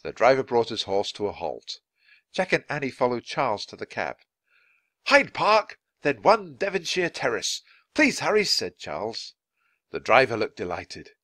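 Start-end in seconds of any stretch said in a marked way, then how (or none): 5.1-8.4 high, raised, urgent instructions; eager directions. A narrator's reading. Charles to the cab driver, eager. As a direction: give directions, keen to be off.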